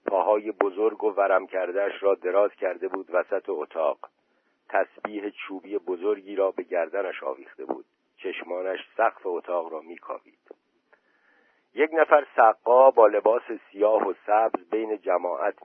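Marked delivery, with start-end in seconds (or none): none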